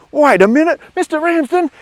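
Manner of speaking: very high, loud shriek of disbelief; acted kid exploding in high-pitched astonishment; outraged realisation. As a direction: escalate to a squealing shout at the teacher. Kid's outburst builds on realising.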